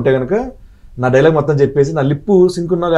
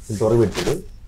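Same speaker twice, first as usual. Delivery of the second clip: normal talking voice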